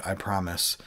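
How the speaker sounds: ordinary delivery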